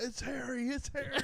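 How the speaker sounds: mock freakout. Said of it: "He's like".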